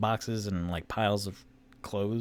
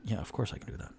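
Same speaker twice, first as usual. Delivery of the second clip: lightly voiced Rainier; 3 semitones lower, 7 dB quieter, much faster than usual. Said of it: "And then Rainier's".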